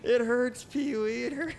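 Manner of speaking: high whimpering cartoon puppet; squeaky pained whine; whiny puppet voice. Burning puppet cries out.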